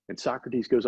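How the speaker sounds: ordinary talk, nothing marked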